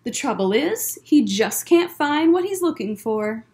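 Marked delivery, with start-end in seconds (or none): none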